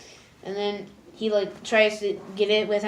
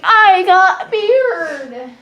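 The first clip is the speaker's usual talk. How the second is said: very slow, loud, much higher cry; triumphant shout; 10 semitones higher, 13 dB louder, much slower than usual. Retold or imitated. Marco showing off his new beard, slow triumphant shout. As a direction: crow it out proudly.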